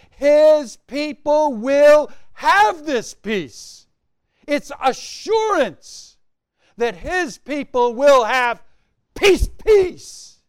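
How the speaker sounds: slow, high, loud "peace, peace" peak; preaching at full force. Real, not acted. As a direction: proclaim the promise, stretch it out. Sermon peak on assurance.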